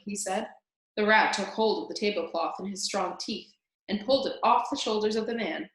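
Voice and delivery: ordinary delivery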